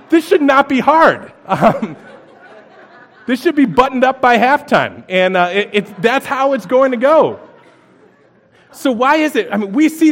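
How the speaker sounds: laughing sports-fan excitement. Real, not acted. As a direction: get carried away with a joke about a sure win. Football comparison, laughing, excited.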